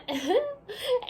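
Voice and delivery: a quick real laugh at her own slip; light laugh. Real, not acted. Teacher laughing at her stumble while reading to the class. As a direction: laugh it off mid-sentence.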